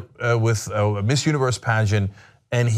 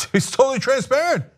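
high, sing-song mock of administration spin; mock Trump spokesman voice insisting he's transparent; mocking spin doctors; 10 semitones higher than usual. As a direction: parody the innocent official line, pitched way up. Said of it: "the Trump administration is all over T V going".

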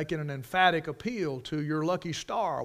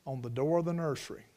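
normal talking voice

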